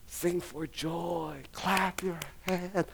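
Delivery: slow proclamation. Real, not acted. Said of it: "No, no, no".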